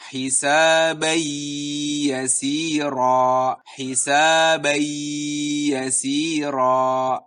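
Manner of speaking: tanwin merged into a held nasal y; gunnah on hisabai yasira. Shown as a demonstration. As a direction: recite hisabai yasira slowly, linking the words. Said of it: "dimasukkan dengan sedikit gunnah".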